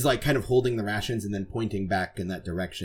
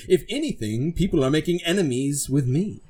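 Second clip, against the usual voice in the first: higher-pitched, lofty protest; indignant knight; 7 semitones higher than usual. Acted. Knight denying blame to a crewmate, indignant.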